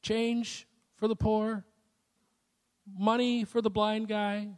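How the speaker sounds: soft, unobtrusive begging; lightly voiced beggar asking politely; mild beggar's call. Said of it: "about once a minute kinda go".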